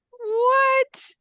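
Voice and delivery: high, loud disbelieving what; stunned what. Retold or imitated. Reacting to boring news in high shocked disbelief. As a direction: hit it with total disbelief. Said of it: "I was like".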